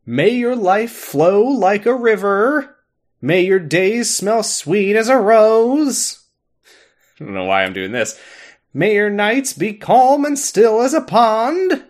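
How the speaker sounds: slow, solemn blessing voice; hammed-up congregation prayer; mock prayer. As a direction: intone it like a benediction. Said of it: "lead our congregation in the Doctor's Prayer".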